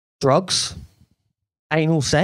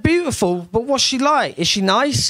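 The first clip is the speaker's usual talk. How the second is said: eager questions; 8 semitones higher than usual. Retold or imitated. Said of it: "And I said".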